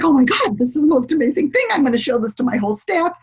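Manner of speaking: a hint of gushing Oprah, quick; excited talk-show host, lightly done; mildly imitating an amazed host; lightly voiced Oprah. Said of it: "She's like".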